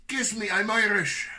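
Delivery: high, loud hammed-up Irish catchphrase; mock Irish. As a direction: toss off a jokey brogue.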